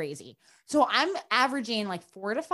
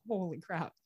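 stunned aside; 4 semitones lower, 9 dB quieter than usual. Retold or imitated. Stunned reaction to surgeon advice.

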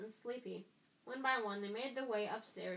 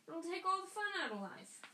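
mocking twin; 10 semitones higher, 4 dB louder than usual. A narrator's reading. George mocks being prefect.

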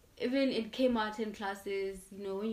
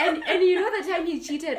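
laughing, higher and louder; giggly, raised voice; 8 semitones higher, 12 dB louder than usual. Real, not acted. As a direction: laugh through the start of the story. Laughing while telling about ex.

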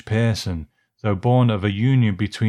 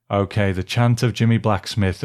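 ordinary talk

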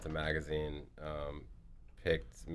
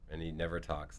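ordinary delivery

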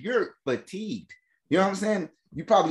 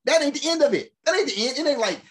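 pitched-up hype that it's not over; emphatic build; 10 semitones higher, faster than usual. Real, not acted. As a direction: tease that more is coming.

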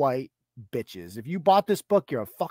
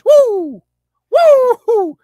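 high, loud hammed-up whoops; cartoonish frantic woo; mock whoops; 12 dB louder than usual. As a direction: yelp like ducking bullets. Mock dodging bullets.

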